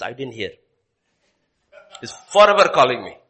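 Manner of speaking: mock whiny excuse; sulky child. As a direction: play the annoyed child under the blanket. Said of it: "what our children will say".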